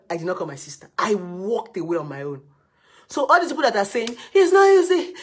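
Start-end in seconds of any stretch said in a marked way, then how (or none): 4.3-5.2 high, loud whiny mimicry; mock the complainers' excuse; ridiculing women who won't leave; mocking whine.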